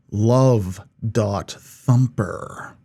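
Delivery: ordinary talk, nothing marked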